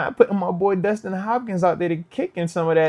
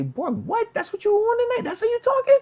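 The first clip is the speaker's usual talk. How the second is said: high incredulous squeal, higher all through; stunned disbelief. Retold or imitated. Host to Jameis, disbelieving high squeal. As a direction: react like you can't believe what he just said. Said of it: "I say".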